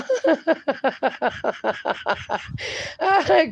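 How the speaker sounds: real, extended giggling; real laughter. Real, not acted. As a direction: laugh on and on, tapering away. Laughs at the garbled message.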